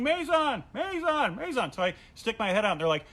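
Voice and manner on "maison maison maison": high calling yell, fading; mild mock yelling. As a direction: call his name from the hot tub. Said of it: "they all start yelling".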